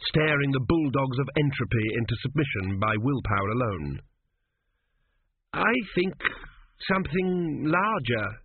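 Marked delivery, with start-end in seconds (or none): 5.5-8.4 higher, hesitant suggestion; hopeless venture. A narrator's reading. Young king timidly asks for a bigger pyramid, hopelessly. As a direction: venture it haltingly, with little hope. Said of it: "he ventured hopelessly".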